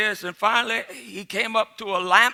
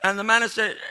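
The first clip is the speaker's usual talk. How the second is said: ordinary delivery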